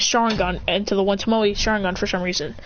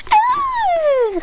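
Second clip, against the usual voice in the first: very high sustained squeal; acted piercing squeal; high fangirl shriek; 4 dB louder than usual. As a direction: scream it out high and long. Girl reacts with scream.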